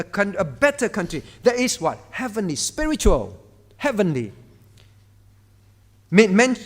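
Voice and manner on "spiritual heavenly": ordinary talk, nothing marked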